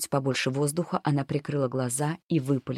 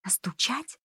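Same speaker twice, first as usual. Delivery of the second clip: hushed, high, disbelieving question; faint, incredulous little voice; barely audible whisper; 8 semitones higher, 7 dB quieter, slower than usual. A narrator's reading. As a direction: ask it very softly, pitched up. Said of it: "едва слышно прошептала".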